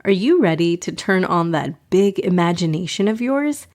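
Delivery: usual speaking voice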